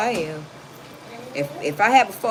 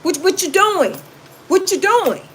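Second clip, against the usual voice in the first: high, louder teasing challenge; sassy playful challenge; 5 semitones higher, 6 dB louder than usual. Retold or imitated. Woman teasing her man, high and sassy. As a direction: tease him like you mean it. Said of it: "Sometimes you gotta be like".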